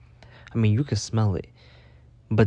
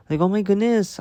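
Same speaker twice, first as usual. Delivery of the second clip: exasperated; 10 semitones higher, 4 dB louder than usual. Real, not acted. Exasperated comment.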